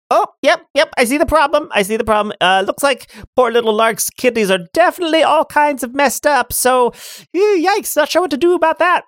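high cackling witch greeting. Acted. GM as the Dimensional Witch, high and gleeful.

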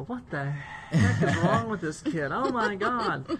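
high, loud, drawn-out dismay; mock exasperation. Bosses griping about the intern, in loud mock exasperation. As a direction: play up comic despair over a hopeless case.

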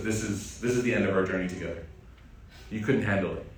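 a hint of a grave farewell; solemn Khidr. Khidr voiced ending journey.

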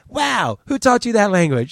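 hammed-up reporter clutching pearls; shocked retort. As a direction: play the prissy scandalized reporter. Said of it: "He retorts".

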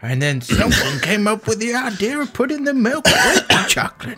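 put-on high, old-timer prospector voice; grizzled old prospector reminiscing. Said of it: "being an old prospector".

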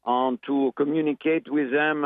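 put-on Parisian French accent; chatty French-accented English. Shown as a demonstration. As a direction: slip into a French accent mid-explanation. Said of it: "I've been to Paris as well".

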